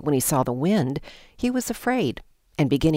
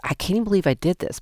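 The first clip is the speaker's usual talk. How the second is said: normal talking voice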